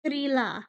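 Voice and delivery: Grýla as GREE-la, rolled r, falling tune; Grýla, careful native say-it; correct Icelandic. Shown as a demonstration. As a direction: model Grýla for viewers to copy. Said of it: "That's how you say it".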